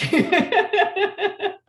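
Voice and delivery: loud high laughter; hearty loud laugh. Real, not acted. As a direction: burst out laughing. Group laughs at teasing.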